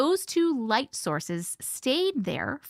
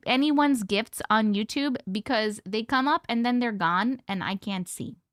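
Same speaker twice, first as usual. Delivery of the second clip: ordinary talk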